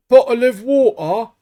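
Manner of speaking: exaggerated Cockney-style British accent, high and loud; mock British accent. Mocking how Americans think Brits talk, hammed-up British accent. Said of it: "everyone sounds like this in England".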